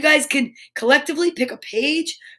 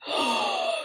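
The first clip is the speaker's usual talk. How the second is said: high gasp of surprise; sharp surprised gasp; 10 dB quieter than usual. Real, not acted. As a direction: gasp in shock. Surprise at reveal.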